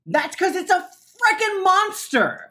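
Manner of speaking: high, loud panic; panicked realization. Acted. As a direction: snap from calm to terror. Realizes it's a monster.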